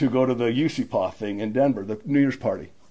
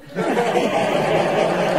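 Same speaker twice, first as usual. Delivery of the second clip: breathless, airy laughter; helpless laughing after the punchline; real laugh; 11 semitones higher, 4 dB louder than usual. Real, not acted. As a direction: let out a wheezy laugh.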